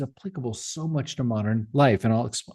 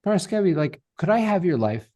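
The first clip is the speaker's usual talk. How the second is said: higher, lightly mocking tech boss pitch; mock Zuckerberg; 6 semitones higher than usual. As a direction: play a breezy billionaire asking for your life, tongue in cheek. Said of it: "Mark Zuckerberg were to call you up and say".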